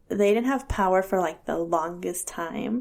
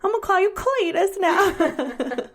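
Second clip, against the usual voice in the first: teasing drawl; 10 semitones higher than usual. Retold or imitated. Teasing son as hillbilly.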